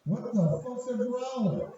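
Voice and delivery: baffled question. Retold or imitated.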